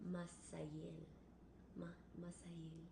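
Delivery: "Masael" softly sounded out in syllables, ma-sa-EL; fancy name pronunciation. Shown as a demonstration. Host trying to make a viewer's name fancy, "Masael" softly as ma-sa-EL. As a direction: try out "Masael" quietly, with an s in the middle. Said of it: "I'm trying to make your name fancy".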